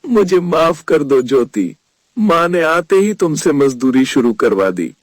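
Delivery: low, downcast male voice; understated, dejected apology; sad. A narrator's reading. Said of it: "धीरज ने उदास होकर कहा".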